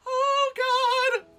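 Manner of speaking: high, loud groaning outcry; exasperated wail. Real, not acted. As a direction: cry out in disgust at the corny line. Agonized reaction to corny film line.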